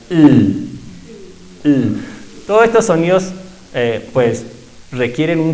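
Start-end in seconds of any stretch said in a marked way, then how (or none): 0.1-2.0 I with lips half closed and tongue pulled back like U; sixth vowel demo. Shown as a demonstration. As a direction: demonstrate I, lips not stretched. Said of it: "la lengua como en la U".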